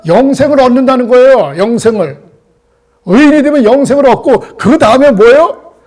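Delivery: loud, high, driving sermon climax; preaching at full force. Acted. Preacher driving the point home to the congregation, at full force.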